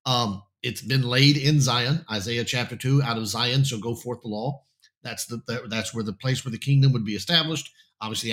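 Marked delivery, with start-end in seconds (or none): none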